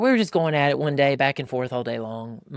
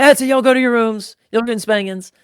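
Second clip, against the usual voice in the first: imitating a dad's angry holler; higher, louder mock order to the kids; fed-up dad; 10 semitones higher, 9 dB louder than usual. Dad sending kids to their rooms, fed-up holler.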